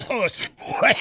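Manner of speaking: coughing through the words; words broken by a cough. Real, not acted. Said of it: "How long did you get this cough over?".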